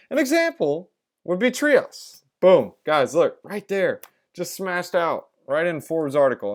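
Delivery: excited high hype about the find; crypto bro hype. Real, not acted. Hyped discovery in article.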